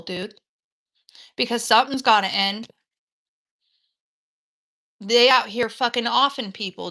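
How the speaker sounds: loud, intense rant at the reader; fired-up preaching. Real, not acted.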